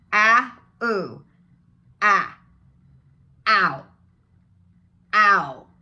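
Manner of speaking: loud AH gliding into OO for OW; diphthong demo. Shown as a demonstration. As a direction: sound out OW in parts, AH then OO, then joined. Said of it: "It starts with".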